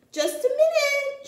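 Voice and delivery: high, loud call; calling back. Acted. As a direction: call them back before they leave. Doctor calls bears back.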